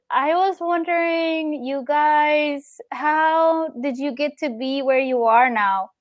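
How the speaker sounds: put-on high, slow, small child's voice; a timid little girl asking slowly; tiny little voice. Little girl asking the players, tiny slow voice. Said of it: "only this little tiny voice".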